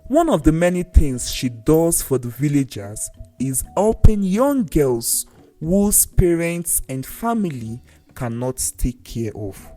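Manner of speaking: usual speaking voice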